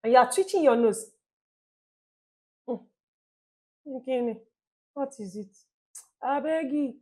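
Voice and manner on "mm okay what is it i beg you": bored, dismissive listener. Pretend. Listener twitching nose, putting off.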